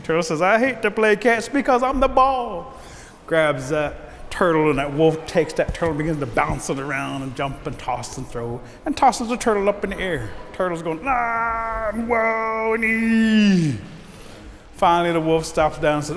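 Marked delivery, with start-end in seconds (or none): 0.4-2.7 high-pitched complaint; protesting turtle. Acted. Turtle protests being the ball. 11.1-13.8 long, high wailing cries; tossed turtle's wail. Acted. Turtle being tossed in air.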